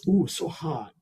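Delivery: imitating overdramatic fake pain; mock burnt yelp. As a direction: overact sipping a too-hot coffee. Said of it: "they pretend like they burnt themselves".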